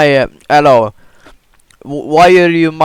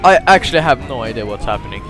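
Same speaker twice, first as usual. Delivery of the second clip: usual speaking voice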